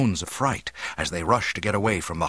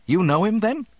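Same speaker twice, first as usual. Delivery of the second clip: high, louder eager question; excited young man; 12 semitones higher, 6 dB louder than usual. A narrator's reading. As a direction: burst out with sudden youthful excitement. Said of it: "Luke climbed excitedly to his feet".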